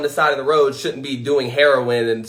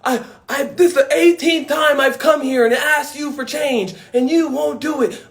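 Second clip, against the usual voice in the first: higher, whiny complaining voice; lightly voiced whiny protester; mock pleading protester; 10 semitones higher than usual. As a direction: whine it like a frustrated petitioner. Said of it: "videos of people".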